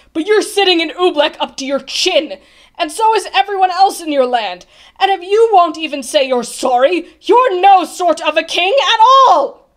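loud, high rebuke building to a shout; berating. A narrator's reading. Bartholomew tells the king he is no king, berating.

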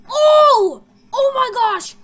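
loud, high burst of shock; startled cry. Real, not acted. Player spots a threat on camera, startled cry. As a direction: exclaim in sudden fright.

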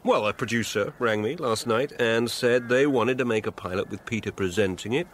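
ordinary talk

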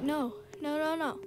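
usual speaking voice

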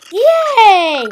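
loud, high cheer; excited cheer. Real, not acted. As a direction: shout it out happily. Cheers at picking the map.

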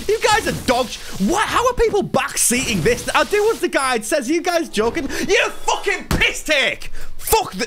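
real exasperated outburst, high-pitched and rising; high-pitched, incredulous annoyance; tilted rant. Real, not acted. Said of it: "Sorry, I'm a bit tilted".

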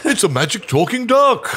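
amused excitement. Real, not acted. GM laughs that the duck can talk. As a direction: burst out laughing in delight.

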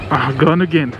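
usual speaking voice

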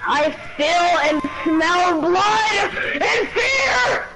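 slow, drawn-out villain shout; hammed-up bloodthirsty maniac; crazy guy. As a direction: shout it slowly like a crazed killer. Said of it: "acting like a crazy guy".

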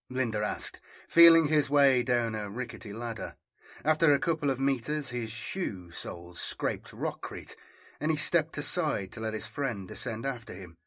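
ordinary delivery

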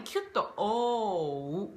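オー ending in a tight lip squeeze; a teacher showing the closing glide; quick closing O. Shown as a demonstration. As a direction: snap the lips shut at the end of オー. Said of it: "という感じで".

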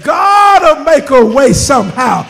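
loud, shouted boast of a preacher; mock confident preacher. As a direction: belt it like a cocky preacher. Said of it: "he sounds like some F A B C bill".

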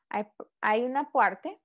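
normal talking voice

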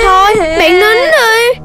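comforting. Acted. Friend comforting crying Đốm.

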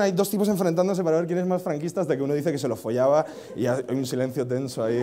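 ordinary talk

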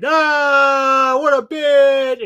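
very high, loud drawn-out mock cry; drunken wail. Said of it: "he's really drunk".

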